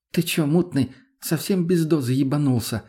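usual speaking voice

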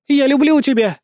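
high-pitched lovelorn wail. A narrator's reading. Countess declaring love among the captives, wailing. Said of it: "воет графиня".

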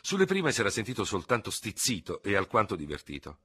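plain reading voice

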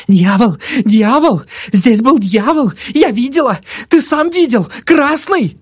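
high-pitched, repeated frightened cries; hysterical babbling. A narrator's reading. Old woman shrunk in armchair, hysterical babbling. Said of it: "бормотала".

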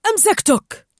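high, loud exclamation; triumphant shout. A narrator's reading. Dentist seizes Nigel, loud high shout. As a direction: shout it as you grab. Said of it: "صاح دكتور شيرمان".

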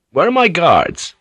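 high-pitched, urgent question falling at the end; alarmed demand. Acted. Alarmed at finding guards gone.

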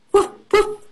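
high playful woof woof; cheerful mock barking; dog barking imitation. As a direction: bark like a small dog for kids. Dog noise in kids' story.

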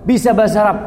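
a teasing question to a worker from Doha; mock quiz. Preacher voices asking a worker.

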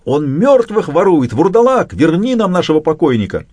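high-pitched accusing cry; acted yelling. A narrator's reading. Villagers yelling at the corpse thief, high mock outcry. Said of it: "заорут".

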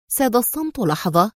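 ordinary delivery

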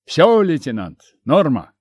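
loud call through headphone speakers; over a pilot headset. Acted. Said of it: "раздалось в динамиках наушников".

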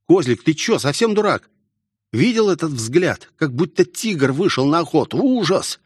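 high-pitched, alarmed telling-off; high, incredulous overheard chiding; scolding companion. A narrator's reading. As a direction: voice an aghast boy berating his mate. Overheard scolding of fleeing boy.

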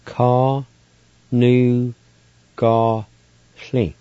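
Ka, nu, ga, sli sounded out slowly; Cherokee word for blackberries. Shown as a demonstration. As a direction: break it into Ka, nu, ga, sli. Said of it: "Number four, blackberries".

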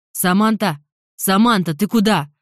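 loud call after someone running off; calling after her. Acted. Jeremy calls after the fleeing Samantha, loud calling. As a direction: shout her name as she runs away.

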